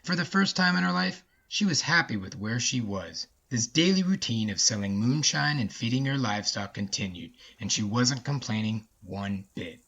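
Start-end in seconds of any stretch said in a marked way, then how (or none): none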